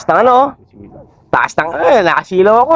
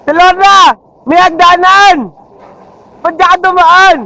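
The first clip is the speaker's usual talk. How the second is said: loud, higher shout across; calling out; 10 semitones higher, 4 dB louder than usual. Real, not acted. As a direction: yell it out to her. Calling out to Lorna.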